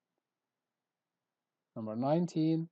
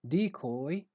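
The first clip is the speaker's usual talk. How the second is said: "decoy" as DEE-coy; stress on first syllable. Shown as a demonstration. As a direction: lean on the full "dee" that opens "decoy". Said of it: "you stress the first syllable".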